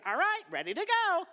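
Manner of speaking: high, loud burst of readiness; eager and ready. Acted. Preacher mimicking someone just woken up, eager and loud.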